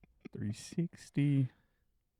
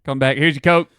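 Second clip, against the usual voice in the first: rude drive-thru worker; 4 semitones higher, 12 dB louder than usual. Acted. Worker roleplay.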